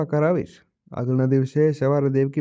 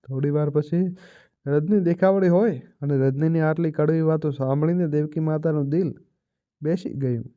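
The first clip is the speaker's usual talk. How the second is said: ordinary delivery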